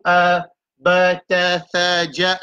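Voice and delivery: each syllable spelled out clearly; modelled sounds. Shown as a demonstration. Teacher sounding out Arabic letters for the class, clipped and clear. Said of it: "c'est le son".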